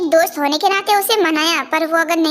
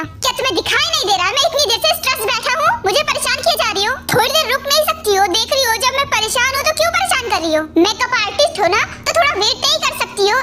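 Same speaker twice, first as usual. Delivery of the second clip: high, fast, irritated scolding; angry outburst; 5 semitones higher, faster than usual. Acted. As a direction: snap at her, fed up and stressed. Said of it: "बहुत ज्यादा गुस्से में बैठा". (ऊँची, तेज़ आवाज़ में झल्लाकर डाँटना)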